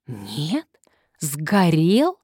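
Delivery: drawn-out, rising disbelieving question; disappointed drawl. A narrator's reading. Visitor learning the old house is gone, disappointed drawl. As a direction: stretch it out, let down and questioning. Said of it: "разочарованно протянул Константин".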